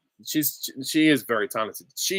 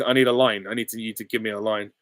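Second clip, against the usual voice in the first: ordinary delivery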